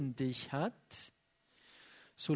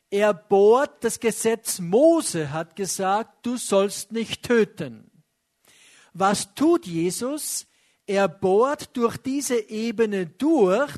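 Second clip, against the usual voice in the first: louder, driving sermon voice; forceful preaching; 6 dB louder than usual. Acted. Preacher hammering his point to the congregation, forceful. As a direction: drive the point home to the congregation.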